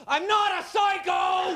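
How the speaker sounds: high, slow shout; yell. Pretend. To the frightened woman ahead, a high slow shout. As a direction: yell it down the empty street.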